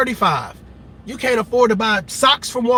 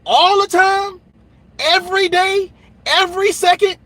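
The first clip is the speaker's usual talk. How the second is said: higher, louder disbelief; incredulous; 7 semitones higher, 3 dB louder than usual. Real, not acted. Answering a partner who blames everything on him, incredulous. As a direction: throw the blame back, incredulous.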